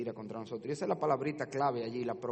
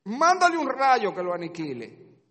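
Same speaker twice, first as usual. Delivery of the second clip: higher and louder accusing cry; shouted demand; 10 semitones higher, 14 dB louder than usual. Acted. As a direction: demand punishment. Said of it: "nuestros pecados le gritan a Dios".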